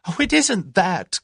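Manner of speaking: high, loud, drawn-out flustered cry; gasped, almost hysterical. A narrator's reading. Tuppence fending off Julius's offer, almost hysterical. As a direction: protest on the edge of hysteria. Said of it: "gasped Tuppence with an almost hysterical laugh".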